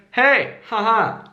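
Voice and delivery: HEY, HAHA falling in pitch; value-giving tone. Shown as a demonstration. Contrasting with needy tone, confident falling HAHA. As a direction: let HAHA drop confidently. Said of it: "the other one is like".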